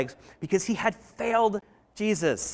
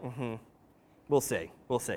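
soft, much lower, skeptical hum and murmur; knowing mm-hmm; 8 semitones lower, 10 dB quieter than usual. Retold or imitated. Jesus answering Peter's boast, quietly skeptical. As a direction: doubt him gently, you know better.

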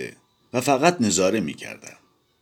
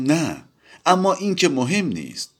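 mischievous; 11 semitones higher than usual. A narrator's reading. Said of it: "با شیطنت گفتم".

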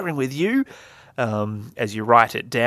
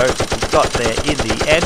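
ordinary talk, nothing marked

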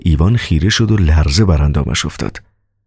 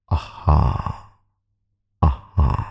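satisfied; 2 semitones lower, 6 dB quieter, much slower than usual. Acted. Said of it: "با لحنی حاکی از رضایت کامل".

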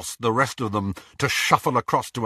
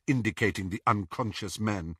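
usual speaking voice

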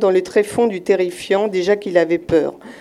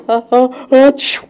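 loud sneeze; 4 semitones higher, 5 dB louder than usual. Acted. Crab sneezes.

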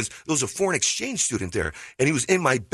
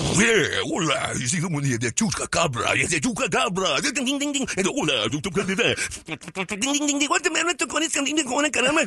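hammed-up Spanglish radio host with mimicked bells; Mexican morning show; 4 semitones higher than usual. Shown as a demonstration. Comedian obliging the host's request, Mexican morning show parody. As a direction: parody a zany Mexican morning DJ, drawn-out greetings. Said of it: "impression of a Mexican morning show".

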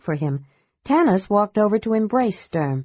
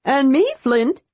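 high, eager cry; 8 semitones higher, 3 dB louder than usual. A narrator's reading. Unseen friend to the dwarf, crying out high. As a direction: pipe up high to announce yourself. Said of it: "cried a voice".

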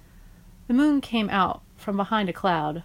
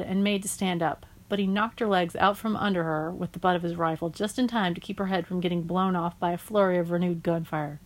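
ordinary delivery, nothing marked

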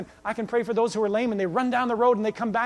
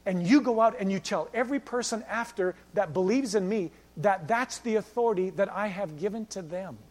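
normal talking voice

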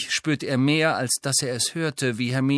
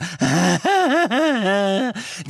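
high, loud witch cackle; mad cackle; 8 semitones higher, 5 dB louder than usual. Acted. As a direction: cackle like a madwoman. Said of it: "gackerndes, wahnsinniges Lachen".

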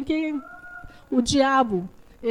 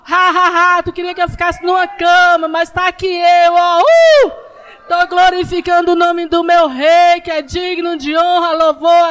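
loud, mocking taunt with laughs; taunting the devil; 3 semitones higher, 7 dB louder than usual. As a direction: gloat right in the devil's face. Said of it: "Dá um sorriso na cara do maligno".

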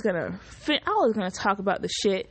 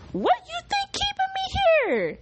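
high put-on cocky voice; mock Eren voice. Fan mocking Eren in a high put-on voice. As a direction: squeak it out as a smug Eren.

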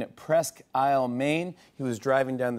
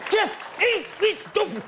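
high mock scolding.

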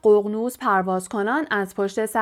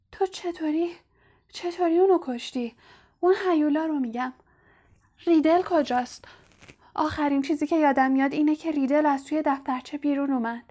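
put-on higher, hushed girl's voice; shaken young girl; 5 semitones higher than usual. A narrator's reading. Ginny asking Harry about Riddle, hushed and higher. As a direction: ask in a small, shaky whisper.

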